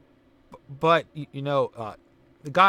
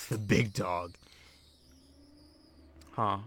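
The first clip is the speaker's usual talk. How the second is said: slow, lower put-on "big dog"; 6 semitones lower, 5 dB quieter than usual. Joker trying out a dog catchphrase, mock-deep and slow. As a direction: play up THE BIG DOG, drawn out and low. Repeats phrase in deeper, drawn-out voice.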